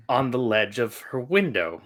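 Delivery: ordinary talk, nothing marked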